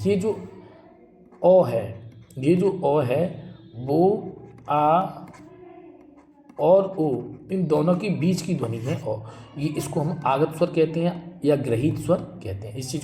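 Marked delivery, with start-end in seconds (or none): 2.4-7.2 ओ said slowly between आ and ओ; vowel between aa and o. Shown as a demonstration. Teacher slowly demonstrating the borrowed ओ sound. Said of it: "आ, ओ के बीच की ध्वनि है".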